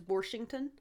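Washington said as Warshington; dad's Maryland pronunciation. Retold or imitated. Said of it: "he does add R's to things".

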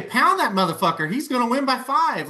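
high, punchy mock quote; emphatic bravado. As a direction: act out a bold, fired-up prediction. Acting out the wished-for bold answer.

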